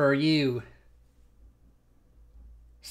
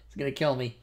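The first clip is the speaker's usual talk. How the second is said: normal talking voice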